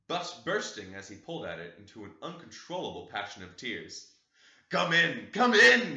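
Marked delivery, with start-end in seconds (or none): 4.7-6.0 high, loud sobbing cry; sobbing plea. Acted. Heathcliff at the window begging Catherine's ghost, sobbing loud and high. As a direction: cry it out through tears, rising. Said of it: "he sobbed".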